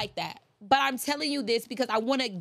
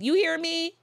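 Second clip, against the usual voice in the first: calling out; 8 semitones higher, 4 dB louder than usual. Acted. Call to the audience.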